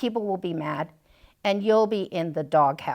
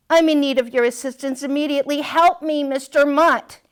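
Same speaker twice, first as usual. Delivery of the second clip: higher, rushed cry for help; urgent plea; 6 semitones higher, 5 dB louder than usual. Acted. Overdressed dog begging Mister Mutt, urgent. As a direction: beg for rescue.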